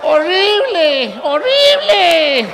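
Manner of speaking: drawn-out, high, loud cry of disgust; horrified shout. Acted. Fan of the rival team recoiling at the purple shirt, drawn-out horrified shout. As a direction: howl it like the shirt burns.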